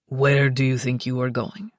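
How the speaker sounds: deep teasing lover; low playful stop; low male voice. Acted. Luca voiced.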